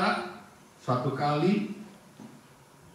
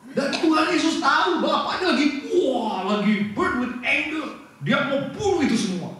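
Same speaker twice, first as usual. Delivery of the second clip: preaching at full force; 11 semitones higher than usual. Acted. Preacher to congregation about God's anger, fervent preaching. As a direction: preach it like the Father's fury burns.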